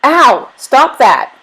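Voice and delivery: high, loud yelp of pain; pained yell. A narrator's reading. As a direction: yell as if just bitten. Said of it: "Thomas yelled".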